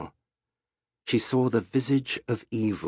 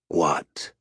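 whispered what; 4 semitones lower than usual. A narrator's reading. Sharp demanding whisper.

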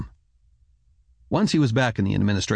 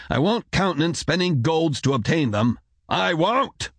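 rising near-shout; 7 semitones higher than usual. A narrator's reading. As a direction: pitch climbing sharply to an insistent refusal, volume held back. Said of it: "rose not quite to a shout".

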